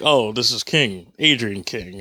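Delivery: slow, low introduction of oneself by surname first; cool, measured, low-pitched name reveal; suave spy-style introduction. Acted. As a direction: drop low and unhurried, savouring the name. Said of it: "I was gonna do something like".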